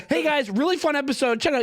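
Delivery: mock host hype; razzle dazzle. Shown as a demonstration. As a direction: sell it like a cheesy host. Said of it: "I'm doing a little razzle dazzle".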